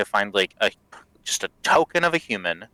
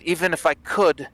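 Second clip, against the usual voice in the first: prisoner voice; 7 semitones higher than usual. Acted. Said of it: "he says".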